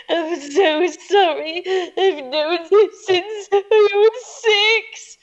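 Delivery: high, slow, wobbly mock crying; imitated sobbing apology. Teenage daughter confessing to her mum, mock sobbing high and slow. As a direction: wail it like a teenager in tears. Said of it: "I burst into tears and I go".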